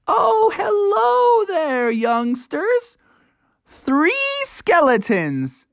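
high, slow, quavering greeting; high, slow elderly homeowner; quavery old person. A narrator's reading. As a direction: greet them like a shaky old neighbor.

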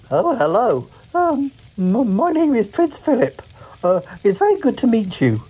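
high, hammed-up royal husband voice; Prince Philip. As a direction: play the prince up high and stiff. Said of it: "this is my husband, Philip".

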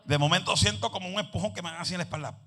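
ordinary talk, nothing marked

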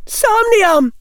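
high, loud spell cry; cried spell. A narrator's reading. Hannah bluffing a sleep spell again, cried high. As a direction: shout the fake spell hard. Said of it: "Hannah cried".

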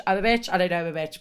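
normal talking voice

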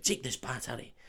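soft put-on Scottish accent; Oliver Wood. Quidditch keeper teaching Harry, hushed Scottish accent. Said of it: "for me to do a Scottish accent".

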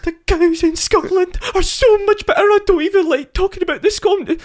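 very high mock Scottish farmer. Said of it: "That's every fucking Scottish farmer".

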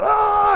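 a high, loud mock scream of a possessed man; mock demon yell. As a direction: let out a wild possessed yell. Mimicking demon-possessed man yelling.

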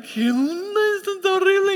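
a spooked gasp of alarm, rising high; shocked exclaim. Retold or imitated. Watching a woman levitate in church, high shocked alarm. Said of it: "y dije".

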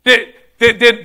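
ordinary talk

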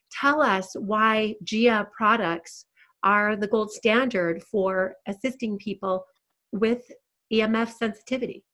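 normal talking voice

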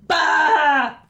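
shouted bleating goat; loud goat bleat. Acted. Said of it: "went the goat".

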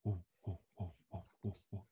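soft low whooshing whoa; imitated tornado whoosh. To his podcast guest, imitating the tornado with a soft low howl. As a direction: breathe it out like a distant wind's moan. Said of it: "I hear this sound like".

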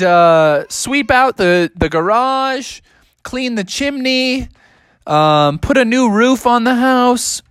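high, slow list of chores; bossy parents. Acted. Parents give Bubby a long chore list, high and slow. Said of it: "his parents were like".

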